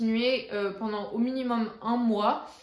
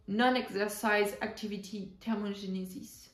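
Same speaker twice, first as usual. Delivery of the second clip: softer English pronunciation by a French speaker; English accent; 4 dB quieter than usual. Pretend. French vlogger showing off an English term to viewers, English accent. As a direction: put on your best English accent, a little quieter. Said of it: "avec mon super accent anglais".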